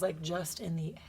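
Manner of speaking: hushed, secretive joke; whispered aside. Real, not acted.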